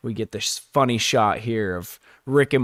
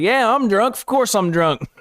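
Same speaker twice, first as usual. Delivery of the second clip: put-on proud drunk; 9 semitones higher, 3 dB louder than usual. Rick admitting drunkenness.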